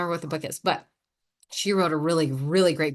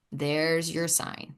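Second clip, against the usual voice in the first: ordinary talk, nothing marked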